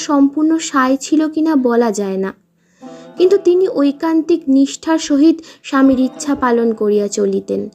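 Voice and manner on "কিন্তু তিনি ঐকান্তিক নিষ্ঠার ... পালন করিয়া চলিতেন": plain reading voice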